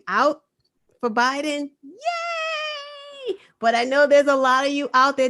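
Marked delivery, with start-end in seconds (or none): 1.8-3.4 a sudden high squeaky yay; high mock celebration; mock cheer. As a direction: toss in a quick sarcastic hooray. Mock cheer for Biden voters.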